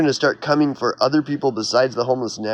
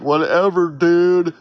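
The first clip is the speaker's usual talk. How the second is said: a slow, lazy party-bro drawl; lightly mock the careless partier; hammed-up stoner bro; 3 semitones higher, slower than usual. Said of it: "It's just like".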